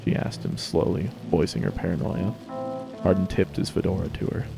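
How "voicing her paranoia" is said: usual speaking voice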